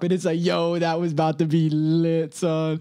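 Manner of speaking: hyped. Retold or imitated. Beat drops at concert.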